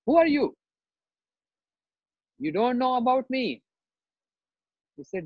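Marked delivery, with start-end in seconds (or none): 0.1-3.6 high, indignant challenge; acted wrathful retort at raised pitch; angry outburst. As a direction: snap back as an offended sage. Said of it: "not angry mood".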